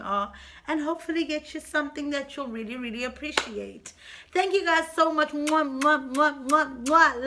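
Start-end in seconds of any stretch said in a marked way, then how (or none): 5.3-7.3 kissy mwah sounds; between kisses. Real, not acted. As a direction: blow kisses at the camera. Blowing kisses to viewers.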